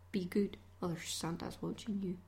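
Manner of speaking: low-key whispered warning; hushed mock warning. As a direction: whisper a playful caution to the little ones.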